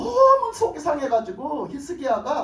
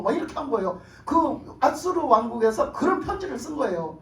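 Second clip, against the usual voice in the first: normal talking voice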